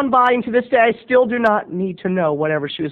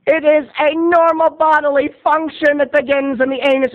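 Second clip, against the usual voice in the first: lecturing teacher; 6 semitones higher than usual. Retold or imitated. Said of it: "and she said".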